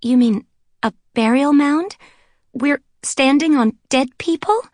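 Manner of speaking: high, loud horrified questions; alarmed shock. A narrator's reading. Blonde learning they stand on a burial mound, horrified. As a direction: recoil in disbelief. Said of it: "eyes widened".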